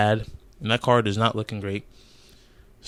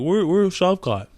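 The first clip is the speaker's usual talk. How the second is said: slow, higher mock whine of a fan; mocking commenter; 8 semitones higher, slower than usual. Vlogger mocking a viewer comment, high slow mock whine. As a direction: parody the clueless commenter. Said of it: "They'll be like".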